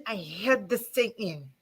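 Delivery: a quiet, restrained confession; emotional, quiet. Real, not acted. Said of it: "I'm getting emotional".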